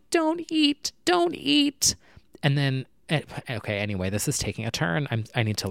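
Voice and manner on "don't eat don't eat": very high, tiny squeaky plea; little movie shrimp. Acted. Little shrimp from Shark Tale pleading, tiny squeak. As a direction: plead like a minuscule cartoon creature. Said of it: "the little shrimp, and they're like".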